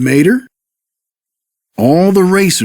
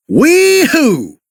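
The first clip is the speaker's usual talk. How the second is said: higher, thrilled whoop of joy; excited yell; 10 semitones higher, slower than usual. Acted. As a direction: holler it like a fun ride. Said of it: "yelled Mater".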